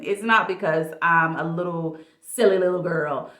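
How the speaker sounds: ordinary delivery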